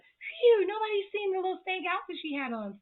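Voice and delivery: high, put-on voice of a relieved mom; high-pitched mock relief; relieved Charlotte. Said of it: "Charlotte's like".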